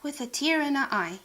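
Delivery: ordinary delivery, nothing marked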